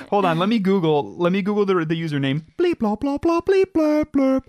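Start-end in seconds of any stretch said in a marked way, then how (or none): 2.6-4.4 very high-pitched robotic bleeping; mock computer noises. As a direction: babble like a pretend search engine. Mimes computer noises while googling username.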